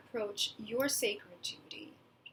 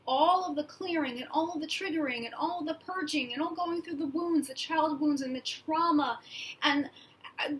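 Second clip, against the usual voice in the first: real rising emotion, higher-pitched and pressing; emotional, urgent; 4 semitones higher, 3 dB louder than usual. Real, not acted. Reader telling followers what they've been preparing for, emotional and urgent. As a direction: pour it out with mounting urgency. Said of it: "Wow, I'm getting emotional here".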